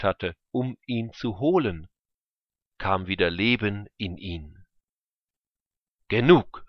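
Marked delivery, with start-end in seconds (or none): 6.1-6.6 loud, sudden exclamation; crying out. A narrator's reading. Father convinced at last that his son lives, crying out. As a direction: cut in with a loud cry. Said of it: "rief er".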